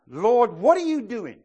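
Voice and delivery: slow, loud, high plea to God; earnest prayer. Acted. Believer asks the Lord in earnest prayer. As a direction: ask God sincerely.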